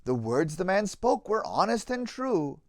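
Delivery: put-on lively rhyming read-aloud for children; story narration. A narrator's reading. Narrator reading a children's Easter tale, lively storytelling. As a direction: tell the tale with bounce and warmth in the rhythm.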